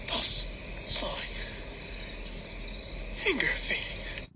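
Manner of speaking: usual speaking voice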